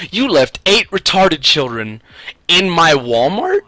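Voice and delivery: high, loud and slow indignation; imitating an appalled store clerk; outraged clerk. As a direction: scold him in disbelief. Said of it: "she goes".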